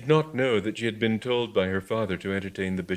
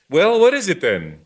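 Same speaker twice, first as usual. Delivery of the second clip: angry challenge; 10 semitones higher, 10 dB louder than usual. Acted.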